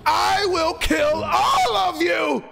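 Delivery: slow, high, hammed-up shout; mock villain threat. Player taunting enemies with a slow hammed-up threat. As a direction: ham up a slow menacing vow.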